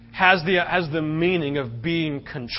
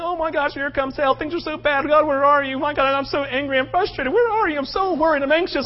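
higher, fretful voice listing every worry; mock anxious prayer; 8 semitones higher than usual. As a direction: imitate a worried believer wailing at God.

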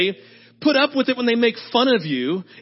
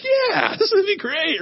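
put-on sarcastic teen; 9 semitones higher than usual. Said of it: "Sarah's like".

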